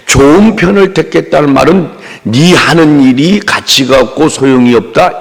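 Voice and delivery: ordinary talk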